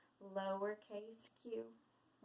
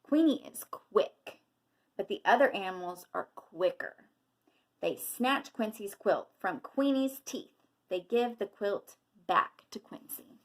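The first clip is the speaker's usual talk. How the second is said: picture-book narration, projected and measured; reading to children; 11 dB louder than usual. A narrator's reading. Teacher reading a quail story to children, storybook narration. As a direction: read it out with lively emphasis.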